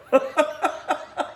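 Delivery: high burst of laughter; bright amused laugh; real laugh. Real, not acted. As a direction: crack up at the memory. Recalling the guy's "no duh.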